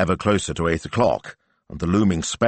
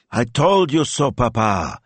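higher, louder told-you-so; gloating son; 6 semitones higher, 4 dB louder than usual. Acted. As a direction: crow it at your father. Ivano gloating to his father.

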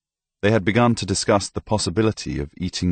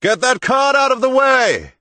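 much higher, loud bellow; shouted order; 6 dB louder than usual. Acted. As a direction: yell it at the cart from horseback. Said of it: "A voice shouted".

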